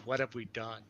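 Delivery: low, very quiet lament; softly acted despair, pitch dropped; hushed mock dismay. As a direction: say it under your breath, as if appalled at your own creation. Imagined creators looking down, dismayed.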